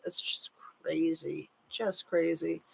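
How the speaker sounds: hushed disbelief; whispered. Real, not acted. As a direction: drop to a stunned whisper. Disbelief over rumor.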